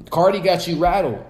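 ordinary delivery

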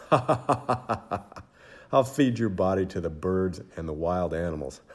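a giant gloating with a cruel laugh; laughing giant's threat. Acted. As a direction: taunt the boy while laughing at him. Goliath laughing menace.

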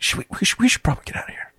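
urgent hushed whisper. Acted. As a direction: lean in and whisper the plan. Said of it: "she's like".

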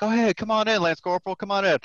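high-pitched, breezy imitation of a welcoming boss; mock-friendly welcome. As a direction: imitate a sergeant major waving someone in. Sergeant major welcomes him in.

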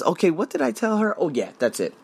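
ordinary talk, nothing marked

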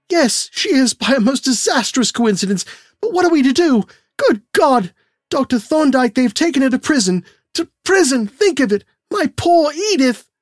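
frantic, on the edge of tears; father pleading with Thorndyke for his daughter; distraught father. A narrator's reading. Curtis distraught.

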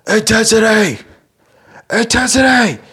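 high, loud imitation of a yelling coach; mock drill-style scream, far above normal pitch. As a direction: scream it like an intense coach at practice. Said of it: "typically screaming at us".

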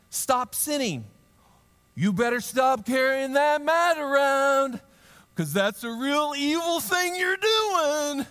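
high, loud, slow overblown warning; mock stern scolding. Jesus scolding the healed man, hammed-up stern warning. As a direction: ham up a scolding preacher. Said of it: "I don't think Jesus said it like that".

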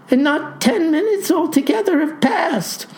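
high-pitched, flustered protest; in quite a state. A narrator's reading. Clerk counts the minutes between hair changes, in quite a state. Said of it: "in quite a state".

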